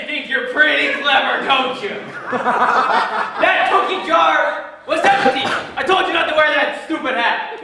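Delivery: loud, high, hammed-up accusation; bad-cop tirade. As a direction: rant at the suspect, laughter breaking in. Bad cop confronting suspect over empty jar.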